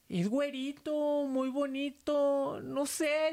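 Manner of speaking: high, slow, drawn-out answer; imitated sister. Sister describing the boy to the nurse, high imitated voice. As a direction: imitate a patient's high voice, stretching each phrase.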